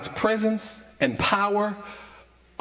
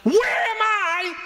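preacher voicing Jesus, building to a high shout; 6 semitones higher than usual. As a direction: let Jesus' voice swell to a shout. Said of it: "I can just hear him say it".

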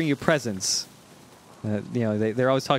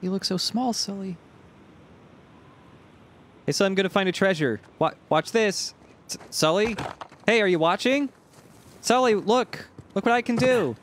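put-on higher, childlike begging voice for attention; needy kid calling Sully; 4 semitones higher than usual. Gamer voicing Nate as a whiny kid wanting Sully's attention. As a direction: play a little kid desperate for Sully to watch.

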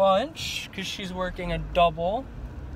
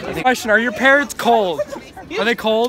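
raised voice; 5 semitones higher, 6 dB louder than usual. Real, not acted. Asking about parrots, raised voice.

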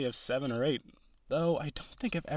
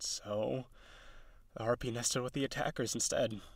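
dejected confession; 4 semitones lower, 7 dB quieter than usual. Acted. Confessing her failed courtships.

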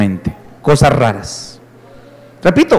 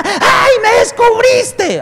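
high, slow demon screech; hammed-up possessed shriek; possessed scream; much slower than usual. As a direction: wail like a demon caught out. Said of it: "el que grita ahorita".

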